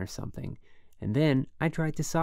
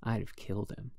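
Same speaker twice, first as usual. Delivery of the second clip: usual speaking voice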